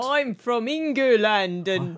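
very slow, hammed-up British accent; badly faked English accent, drawn right out; mock English accent. Corrected to say England, in a mock English accent. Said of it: "imitate English accents when they're over here".

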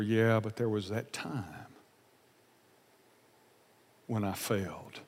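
understated grief, sinking softly at the end; quiet regret. Acted. Preacher voicing Peter recalling his denial, quietly regretful. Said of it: "I'm sure Peter grieved on some level".

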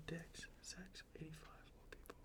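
hushed whisper of keywords; breathy secret whisper. Pretend.

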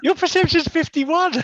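loud, high, slow disbelieving shout; incredulous shout. Real, not acted. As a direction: yell it in disbelief. Disbelief at teammate's failed roll.

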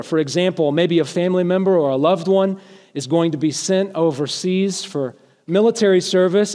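normal talking voice

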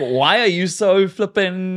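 loud, high, drawn-out mock confrontation; hammed-up exasperated challenge; cocky. Shown as a demonstration. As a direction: act out picking a fight, cockily. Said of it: "don't say it in a cocky way".

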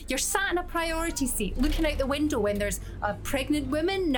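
ordinary talk

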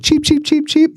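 high-pitched imitation cheeps; tiny bird chirping. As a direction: chirp like a harmless little bird. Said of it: "like, tiny bird".

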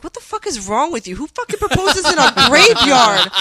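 outraged retelling, building to a loud, laughing peak; retold yelling. Fiancée to the man proposing in a graveyard, retold outraged yell. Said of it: "as I'm yelling at him".

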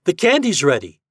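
loud, high announcement; rallying shout. A narrator's reading. Toad summons the boys for pulling, shouting. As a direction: shout the news to the group. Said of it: "shouted Toad".

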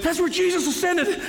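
high, breathless imitation of a runner; breathing while running. Runner filming where Jesus ascended, comically out of breath. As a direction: act winded, voice pushed way up, laughing at yourself. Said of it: "I'm breathing, trying to".

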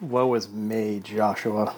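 hammed-up woe is me; mock lament.

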